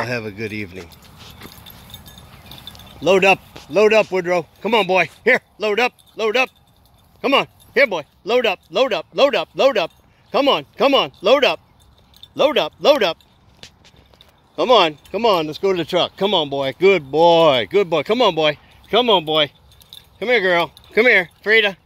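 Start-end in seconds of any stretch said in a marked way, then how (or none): 3.0-16.2 hurrying someone. Real, not acted. Calling dogs to load up. 16.2-21.8 coaxing dogs. Real, not acted.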